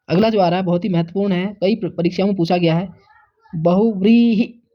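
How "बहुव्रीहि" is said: बहुव्रीहि said slowly, syllable by syllable; बहुव्रीहि pronounced carefully for the class; slow careful pronunciation. Shown as a demonstration. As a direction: spell out बहुव्रीहि slowly so the students hear each vowel. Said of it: "बहुव्रीहि दीर्घ नहीं होता".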